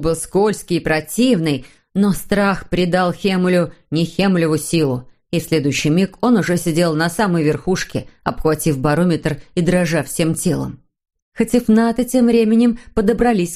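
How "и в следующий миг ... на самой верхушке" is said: ordinary delivery, nothing marked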